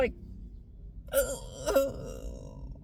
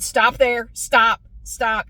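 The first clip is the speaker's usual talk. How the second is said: louder stop; 8 dB louder, slower than usual. Retold or imitated. Patient cuts off the dentist.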